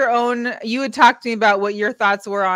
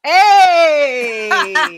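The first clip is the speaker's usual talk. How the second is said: loud, higher whooping hey; said with your chest; 5 semitones higher, 9 dB louder than usual. Real, not acted. Co-host answers the call to say it with her chest, loud whoop. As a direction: belt it from the chest. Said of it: "Say it with your chest again".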